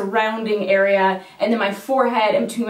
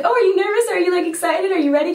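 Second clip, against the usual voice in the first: higher, sing-song mock questions; chirpy injector; 8 semitones higher, faster than usual. Injector checking on her before the lips, mock chirpy. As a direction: tease him as overly perky.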